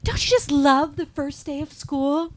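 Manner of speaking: high, loud gushing schoolgirl impression; chirpy mock Patty Simcox. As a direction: gush it in a drawn-out, high voice. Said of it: "and she's like".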